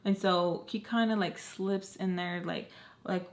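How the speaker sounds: ordinary talk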